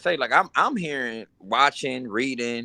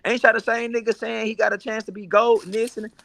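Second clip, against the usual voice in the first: high, incredulous mock challenge; 11 semitones higher than usual. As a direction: pitch way up to call them out. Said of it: "And I'm like".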